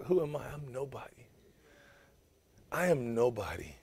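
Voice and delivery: soft, small voice of a man who feels like nobody; humbled Moses. Acted. Moses to God, saying he is nobody, humbled and soft. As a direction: Moses shrinking before God, almost whispered.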